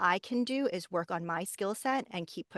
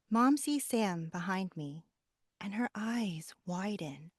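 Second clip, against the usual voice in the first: audiobook reading with character voices; story narration. Shown as a demonstration. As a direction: read it like an audiobook. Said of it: "so I'll just do a quick, very quick little blurb".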